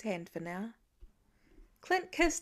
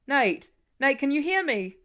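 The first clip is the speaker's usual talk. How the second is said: loud urgent call; father yelling for lost son; 5 semitones higher, 9 dB louder than usual. A narrator's reading. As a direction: shout for him as you run. Father calling, running.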